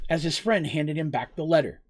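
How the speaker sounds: ordinary delivery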